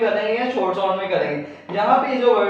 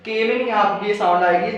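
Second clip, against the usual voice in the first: normal talking voice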